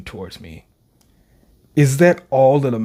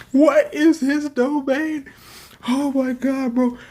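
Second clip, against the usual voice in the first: awed; 12 semitones higher, slower than usual. Real, not acted. Awed at the domain.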